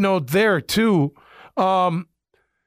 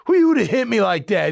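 retold angry yelling; 4 semitones higher than usual. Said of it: "he, like, literally snapped".

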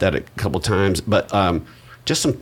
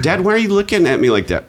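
put-on kids' complaint; 11 semitones higher, 3 dB louder, faster than usual. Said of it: "my kids will go".

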